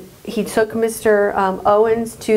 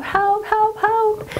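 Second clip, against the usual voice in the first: mock screaming for help; 12 semitones higher, slower than usual. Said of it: "she was screaming for help".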